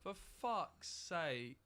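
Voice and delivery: exasperated. Real, not acted. Streamer fed up after the loss, a slow exasperated curse. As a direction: stretch it out, fed up. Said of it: "I'm so mad right now".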